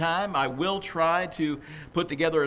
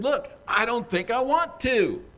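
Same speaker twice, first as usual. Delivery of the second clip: agitated; 8 semitones higher, 4 dB louder than usual. Acted. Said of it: "getting more and more agitated".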